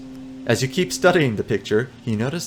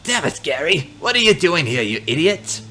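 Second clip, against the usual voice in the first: put-on angry old man; 6 semitones higher, 4 dB louder than usual. Old man berating his son.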